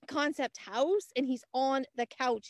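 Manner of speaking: usual speaking voice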